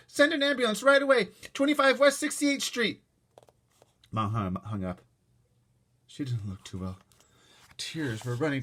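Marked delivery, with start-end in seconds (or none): 0.1-3.0 high, loud and slowed; urgent demand. A narrator's reading. Mother urging the operator on the phone. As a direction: insist on the address. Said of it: "my mother told the operator".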